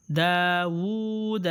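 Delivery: داوود drawn out slowly; slow reading demo. Shown as a demonstration. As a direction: stretch داوود with a long oo. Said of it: "یہ بھی واو مدہ کے جیسا".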